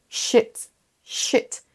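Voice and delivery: "shit" with a short, lax I. Shown as a demonstration. Teacher showing the rude word, short I in "shit". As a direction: clip the vowel in "shit". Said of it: "Si vous faites un I court".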